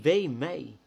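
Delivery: earnest prayer. Acted. Pastor praying before his small congregation, earnest and pleading. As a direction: pour out the heart in prayer.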